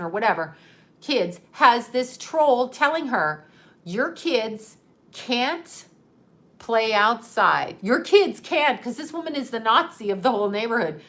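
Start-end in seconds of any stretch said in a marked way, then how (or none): 3.8-8.8 slow, drawn-out mock decree; mock officious neighbor, dragging each word; imitated bossy scolding. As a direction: lay down the rule, word by slow word. Voices troll scolding the mother.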